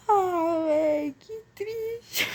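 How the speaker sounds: voz chorosa, bem devagar; choro de verdade. Real, not acted. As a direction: solta o lamento entre lágrimas, arrastado. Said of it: "eu fiquei triste agora". (choramingo real)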